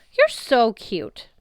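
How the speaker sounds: lightly voiced teary love goddess; gushing goddess. A narrator's reading. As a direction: melt over the boy. Aphrodite tearing up.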